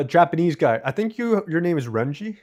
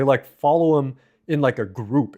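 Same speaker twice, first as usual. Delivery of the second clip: ordinary delivery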